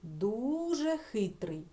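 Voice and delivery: Дуже хитрий said slowly, testing it; teacher testing a word aloud; slow trial phrase. Shown as a demonstration. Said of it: "замінити на слово дуже".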